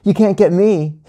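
put-on high, teasing kid voice; playful taunt. A narrator's reading. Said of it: "Colin laughed".

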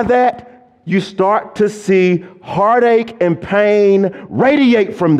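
high, loud preaching that climbs through a list; full-force preaching. Acted. Preacher driving the congregation toward the image of God, high and loud. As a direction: preach it to the rafters, building.

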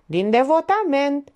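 slow, high-pitched mock praise; ironic. A narrator's reading. Penciu mocking Titel's reason for following her, ironic. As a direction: stretch it out with irony. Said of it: "Celălalt nu sesiză ironia".

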